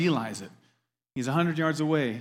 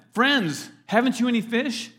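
raised, higher-pitched call; 6 semitones higher, 4 dB louder than usual. Acted. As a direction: call out to friends at a distance. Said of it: "Jesus called out to them".

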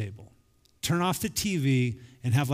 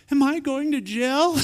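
higher, louder panicky question; mock panic; 10 semitones higher, 4 dB louder than usual. Bully girl asking her friends, in mock panic. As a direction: squeak it out like a caught kid.

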